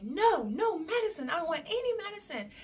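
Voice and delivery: high, quick, insistent protest; high-pitched comic objection; adamant mock refusal. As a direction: act out refusing, voice jumping up. Retold refusal of offered medicine.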